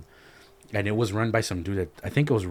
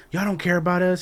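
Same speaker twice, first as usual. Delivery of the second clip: higher-pitched retold protest; mock accusation; 9 semitones higher, 4 dB louder than usual. Mother telling the crowd they don't care, mock accusation. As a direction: imitate an aggrieved mother protesting.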